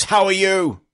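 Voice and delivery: loud, high, full-force bellow; bellowed greeting. Acted. Reading out June's question, bellowed. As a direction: shout the question like a booming hail. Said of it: "who bellows".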